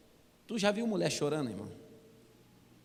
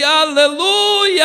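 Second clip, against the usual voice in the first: higher, loud, very slow drawn-out shout; shouted hallelujah; 9 semitones higher, 13 dB louder, much slower than usual. Retold or imitated. He joins the shouting, long and loud. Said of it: "E eu gritei".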